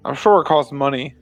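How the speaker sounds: usual speaking voice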